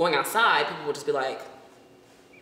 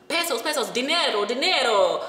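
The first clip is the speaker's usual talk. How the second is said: higher mock demand for money; hammed-up insistent vendor; imitating the attendant; 7 semitones higher, 4 dB louder than usual. The lawn chair guy demanding payment, mock insistent.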